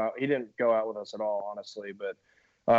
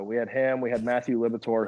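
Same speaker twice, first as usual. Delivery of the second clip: ordinary delivery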